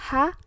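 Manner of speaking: second "H" said as French "ash"; French letter H. Shown as a demonstration. Teacher showing how H is read, French letter sound. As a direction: say "H" as "ash", ending in a long sh. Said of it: "huruf H dibacanya".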